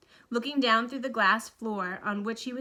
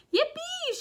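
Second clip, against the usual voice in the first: high whooping yippee; yippee shout; 4 dB louder than usual. A narrator's reading. Grandpa Joe whooping in the rocketing elevator, high yippee. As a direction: whoop with joy as you shoot up. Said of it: "shouted Grandpa Joe again".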